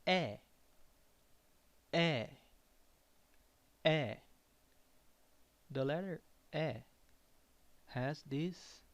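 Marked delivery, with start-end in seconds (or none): 0.1-4.1 E as an open eh, said loud and repeated. Shown as a demonstration. Teacher drills the open E for learners, loud and clear. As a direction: belt out the open E. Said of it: "is pronounced as".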